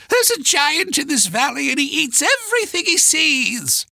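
put-on high-pitched old woman's voice; old woman's warning cry. A narrator's reading. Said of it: "she cried".

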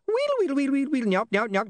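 very high imp gibberish; rapid high squeaks sliding downward; squeaky rewinding noise. Acted. Imp makes playback noises.

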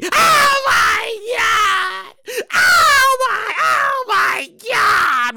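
hammed-up high wail, very drawn out; panicking teller. Teller sees a masked man's note, hammed-up panic. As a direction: stretch every word into a mock-terrified cry. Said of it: "You think automatically".

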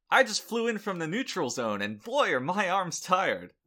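loud, high stand-up punchline voice; old borscht belt comic. Pretend. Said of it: "I'll do my own set for you".

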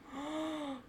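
a sharp, startled intake of breath; shocked gasp. Retold or imitated. Mother hearing the nurse was new, gasping. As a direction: gasp as if caught off guard.

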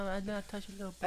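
high, soft pop-star imitation; hushed mock falsetto protest. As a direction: do the soft-spoken singer, half laughing. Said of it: "You sound like M J".

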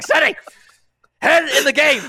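loud, high mock yell; retold yell. Yelling at Seti to focus during firefight, loud and high. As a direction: yank his focus back to the fight.

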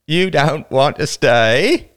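older boy mocking a trespassing girl. A narrator's reading. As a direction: taunt her with the question, dragging it out. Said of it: "repeated the older boy mockingly".

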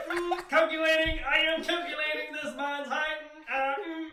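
loud, high, slow mock robot; hammed-up robot voice; robot scanner voice. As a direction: calculate his height like a machine. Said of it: "you're like".

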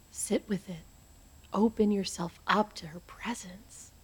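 hushed trance whisper. Acted. Medium guiding contact.

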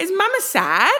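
a lightly mimicked kid's question; imitating small son. Mum quoting her toddler asking about her tears, imitating small son. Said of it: "he was like".

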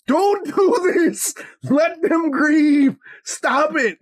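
high, drawn-out mock scream; retold inner scream. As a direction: imitate a conscience screaming at you, stretching the words. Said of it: "screaming at me going".

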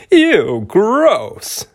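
high squealing disgust. Acted. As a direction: squeal it like a grossed-out dad. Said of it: "he would squeal".

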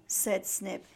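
ordinary delivery

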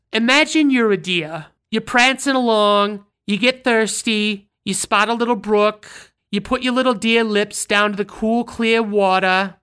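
lilting New York voice painting a gentle scene; New York accent story. Retold or imitated. To Vinny worried about his pants, lilting New York accent. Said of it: "That's the best I can fucking do".

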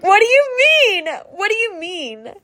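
high, loud incredulous squeal; shocked disbelief. Retold or imitated. Retelling the friend's army news, a high shocked squeal. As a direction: act out stunned disbelief at the news.

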